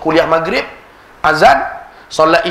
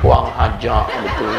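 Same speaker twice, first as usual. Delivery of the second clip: laughing aside; 6 semitones lower, 3 dB quieter than usual. Real, not acted. Laughing mock complaint.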